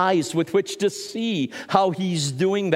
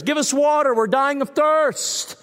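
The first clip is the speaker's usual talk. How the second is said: high, pleading call; yelling sailors; 7 semitones higher than usual. Retold or imitated. Seafarers adrift calling out to fishermen, pleading and high. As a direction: cry out for water, high and desperate. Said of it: "they would yell".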